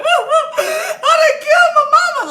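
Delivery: high, loud, drawn-out mock bawling; boo-hoo crying, re-enacted. Daughter convinced the dream killed mama, hammed-up boo-hoo. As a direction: wail it slowly through exaggerated sobs. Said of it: "I was boo-hoo crying".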